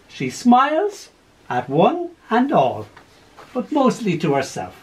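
lively story poem read aloud. Acted. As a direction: tell it with gentle humour. Reads a poem with voiced figures.

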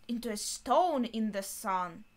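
ordinary talk

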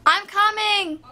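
high, drawn-out call to another room; real holler to someone off in the house. Real, not acted. Calls to someone elsewhere at home.

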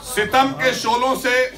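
declaimed Urdu couplet; poem recitation. Acted. Poet presenting chosen lines to the gathering, declaimed recitation.

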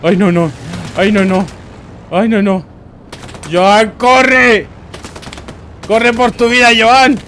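high, loud panicked yells; panicked shouting. Real, not acted. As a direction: scream at him to run. Urging doomed player to flee. (gritos de pánico, muy agudos y fuertes)

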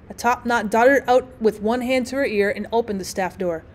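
usual speaking voice

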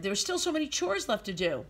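plain reading voice

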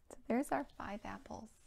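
soft unvoiced whisper. Real, not acted. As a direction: whisper it like a little secret. Aside while setting up tree.

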